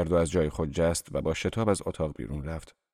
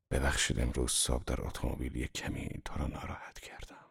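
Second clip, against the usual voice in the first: whisper; 6 semitones lower, 9 dB quieter than usual. Acted. Gatsby leans in to apologize.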